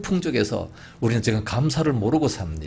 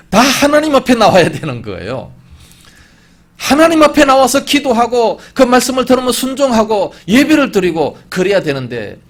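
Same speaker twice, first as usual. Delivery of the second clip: loud, raised preacher's voice; forceful preaching; 8 semitones higher, 8 dB louder than usual. Acted. Preacher urges all to come before God, forceful preaching.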